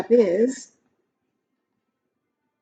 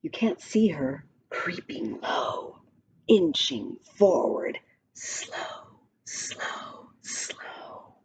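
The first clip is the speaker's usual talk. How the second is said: fading hushed whisper, slower and slower; creeping whisper; 4 semitones lower, 8 dB quieter, slower than usual. A narrator's reading. Reading the cheetah poem to a child, in a creeping whisper. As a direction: breathe it like a stalking cat.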